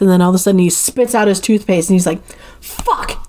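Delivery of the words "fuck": sharp whispered mock shout; panicked curse. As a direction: imitate a sudden curse under the breath. Killer remembers mistake, retold.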